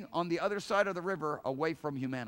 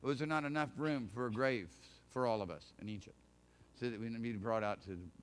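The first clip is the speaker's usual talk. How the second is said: soft, lower, reflective "not"; quiet rephrasing; 9 semitones lower, 5 dB quieter than usual. Shown as a demonstration. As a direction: ask it quietly, turning it over. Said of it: "Or was, really".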